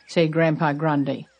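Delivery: ordinary delivery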